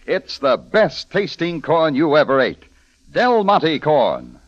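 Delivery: ordinary delivery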